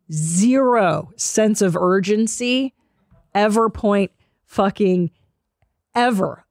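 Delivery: dragged out slowly; mocking the slow pace, drawn out. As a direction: stretch every word like molasses. Drawn-out complaint about Southern slowness.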